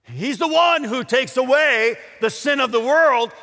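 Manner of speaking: high, loud preaching; forceful proclamation. Retold or imitated. John declares who takes away sin, proclaiming loudly. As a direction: proclaim it to the whole crowd.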